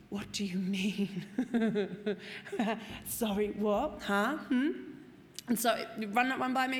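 slow, stunned what; hammed-up stunned confusion; mock disbelief. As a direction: play dumb in disbelief. Disbelief at giving up alcohol.

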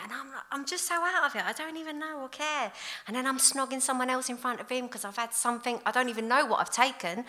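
normal talking voice

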